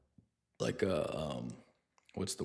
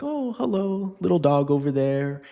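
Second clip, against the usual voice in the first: high, sweet doggy greeting; mock-innocent high-pitched hello; friendly old retriever; 6 semitones higher than usual. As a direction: voice a harmless little dog saying hello. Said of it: "nice little senior golden retriever".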